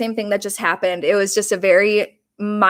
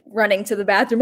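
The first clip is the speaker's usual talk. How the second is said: ordinary talk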